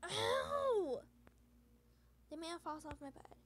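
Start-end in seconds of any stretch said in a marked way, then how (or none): 0.0-1.0 loud, high cry of pain; a sharp loud ow, like a sudden bite; pained yelp. Acted. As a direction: yelp as the finger gets bitten. Man bitten hard on the finger.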